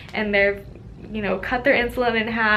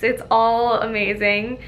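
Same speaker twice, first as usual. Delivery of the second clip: ordinary delivery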